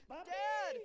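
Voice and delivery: put-on high child's voice calling for his dad; boy calling out. Bound son calling to his father, high child's call. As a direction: call out as a small boy who can't see his father.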